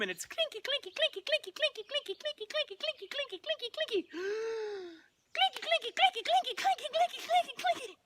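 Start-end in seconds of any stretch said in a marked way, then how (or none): 0.2-5.9 very high, rapid sing-song chatter; tiny high voice imitating clashing blades; squeaky sword clashing. Narrator voices a sword fight, squeaky and high. 5.9-8.0 very high, rapid sing-song chatter; tiny high voice imitating clashing blades; squeaky sword clashing. Narrator voices a sword fight, squeaky and high.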